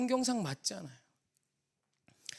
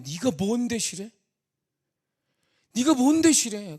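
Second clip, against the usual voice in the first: slow, loud imitated scolding, rising in force; God's angry rebuke; 4 semitones higher, 11 dB louder, slower than usual. Preacher voicing God rebuking him for resting from prayer, angry. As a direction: demand it slowly, louder the second time. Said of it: "하나님 막 화를 내시".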